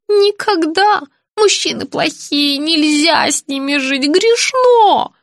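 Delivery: high, drawn-out outburst, rising to a cry; agitated old woman. A narrator's reading. Patient denouncing men as sinful, agitated. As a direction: protest in a high voice, climbing to the end.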